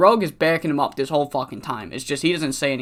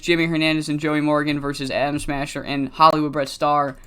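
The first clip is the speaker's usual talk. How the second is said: ordinary talk, nothing marked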